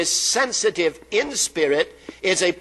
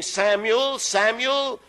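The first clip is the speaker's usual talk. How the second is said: calling voice; 6 semitones higher, slower than usual. Retold or imitated. Voice of God calling the boy Samuel by name. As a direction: call the boy's name gently, higher. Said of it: "he heard a voice saying".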